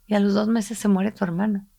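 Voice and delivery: ordinary talk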